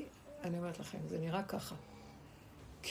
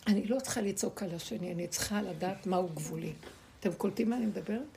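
usual speaking voice

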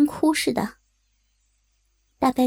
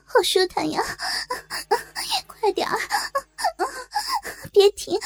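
higher, crying plea; tearful pleading; 11 semitones higher than usual. Acted. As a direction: beg through tears. 哭腔哀求. (带着哭腔、声调升高地哀求)

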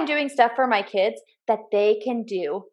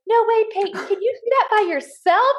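put-on a bright little-boy voice, pitched way up; imitating her young son, much higher; 11 semitones higher than usual. Mom imitating her son cheering on his little sister, much higher. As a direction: play the big brother egging his sister on.

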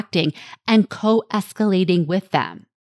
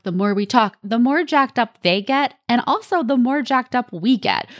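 ordinary delivery

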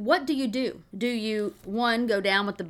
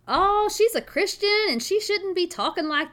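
high, prim voice of a disapproving churchgoer; mock disapproving listener; 9 semitones higher, 3 dB louder than usual. Host mimics listeners who judge her tough talk, in a high prim voice. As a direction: pitch it way up like a scandalised critic. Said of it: "some of you right now saying".

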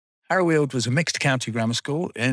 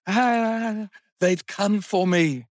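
high, drawn-out mock wail; hammed-up doomed cry, slow and pitched way up; theatrical despair; 10 semitones higher, much slower than usual. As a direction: imitate an eccentric melodramatically giving himself up. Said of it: "fell to his knees and raised his hands in the air and went".

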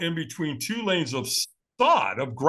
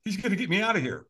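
relieved; 5 semitones higher, 3 dB quieter than usual. Retold or imitated. Acts out his relieved reaction.

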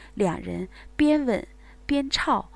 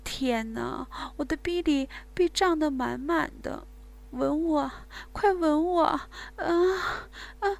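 high, breathy moans and pleas; moaning woman; 5 semitones higher, 3 dB quieter than usual. Acted. Woman to her lover, high breathy moaning. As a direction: gasp and moan, overwhelmed.

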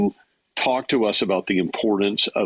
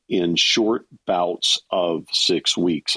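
slow, deliberate phrasing; real slow; slower than usual. Real, not acted. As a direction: spell it out slowly so it sinks in. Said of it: "I'm gonna say that real slow".

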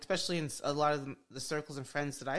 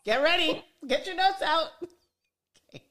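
excited hype; 12 semitones higher, 6 dB louder than usual. Real, not acted. Host hyping the coach.

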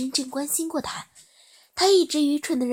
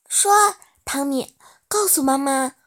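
urging mother; 3 semitones higher, 6 dB louder than usual. Acted. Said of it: "苏珊说道".

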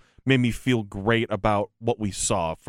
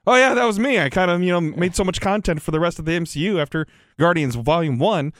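mock casual director; 7 semitones higher than usual.

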